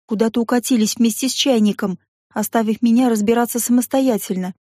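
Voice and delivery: plain reading voice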